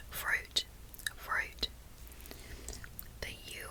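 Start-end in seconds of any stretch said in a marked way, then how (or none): 0.1-1.7 whispered Australian "fruit" with a deep U; Australian "fruit", tongue back near the palate on U; Australian accent. Shown as a demonstration. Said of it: "but in an Australian accent I'll say".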